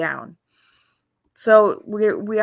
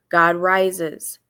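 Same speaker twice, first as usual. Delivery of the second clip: normal talking voice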